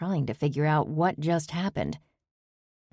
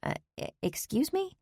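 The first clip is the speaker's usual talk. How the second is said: high, soft, flustered stammer; taken-aback stammer; 12 semitones higher, 6 dB quieter than usual. A narrator's reading. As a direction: trip over the words, caught off guard. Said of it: "Cooper stammered yet again".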